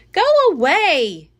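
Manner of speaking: put-on girl shouting at the bratty monkey; angry shout. A narrator's reading. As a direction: drive the monkey off with a yell. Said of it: "Annie shouted".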